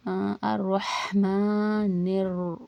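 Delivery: slow, drawn-out Quranic recitation. Shown as a demonstration. Teacher recites the verse for students, slow and drawn out. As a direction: chant it slowly, stretching the vowels.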